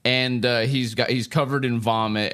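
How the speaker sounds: ordinary talk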